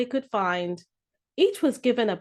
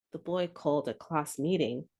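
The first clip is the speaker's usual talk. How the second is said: ordinary delivery, nothing marked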